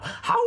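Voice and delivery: long high howl, held out; wolf howl. Shown as a demonstration. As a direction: howl long to lead the children. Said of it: "Why don't you howl at home now?".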